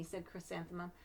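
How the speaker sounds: plain reading voice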